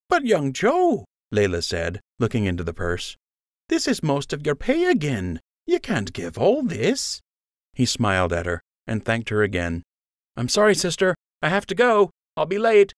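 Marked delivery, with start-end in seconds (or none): 0.1-1.1 high, lightly aged woman's voice; old sister. A narrator's reading. Elderly sister gently scolding Joe, high old voice. 3.7-7.2 high, lightly aged woman's voice; old sister. A narrator's reading. Elderly sister gently scolding Joe, high old voice. 10.4-12.9 hurried apology. A narrator's reading. Joe rushing off, voiced high.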